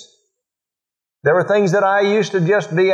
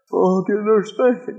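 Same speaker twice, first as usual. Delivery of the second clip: higher, lightly voiced child pleading innocence; a light imitation of a child's excuse; whiny child excuse; 5 semitones higher than usual. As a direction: mimic a kid wriggling out of it. Child's excuse, acted by father.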